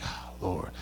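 breathless whispered Oh, Lord; exasperated whisper. Retold or imitated. Pastor despairing at the plumber's excuse, whispered. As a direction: sigh it out under your breath. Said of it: "And I'm like".

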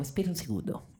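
silly put-on inner voice; voz interior tonta. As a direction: talk stupidly to yourself inside your head. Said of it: "hablas muy estúpidamente contigo para hacia adentro". (voz interior tonta, hablando consigo mismo)

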